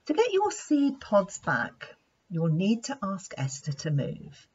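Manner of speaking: plain reading voice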